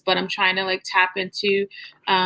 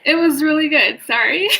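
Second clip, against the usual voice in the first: tearful laugh; 7 semitones higher, 4 dB louder than usual. Real, not acted. Host laughing through tears.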